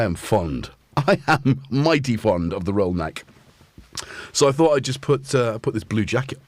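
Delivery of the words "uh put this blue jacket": ordinary talk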